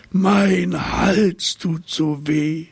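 high, very slow, drawn-out moan; whining giraffe. Acted. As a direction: wail it like a sore-throated giraffe. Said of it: "jammerte die Giraffe". (hohe, sehr langsame Jammerstimme)

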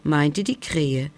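usual speaking voice

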